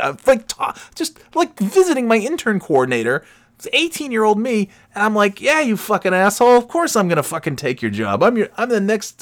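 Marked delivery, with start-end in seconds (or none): 0.5-4.7 high-pitched, incredulous aside; embarrassed, pitched way up; appalled disbelief. Real, not acted. Appalled at his younger self. 5.4-9.2 high-pitched cocky comeback; hammed-up swaggering insult, pitched up; mock trash talk. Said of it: "I'm shit-talking him back".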